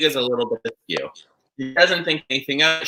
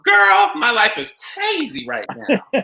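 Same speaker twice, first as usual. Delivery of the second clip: high diva line, half laughing, slowly; 10 semitones higher, 4 dB louder, slower than usual. Retold or imitated. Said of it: "and she goes".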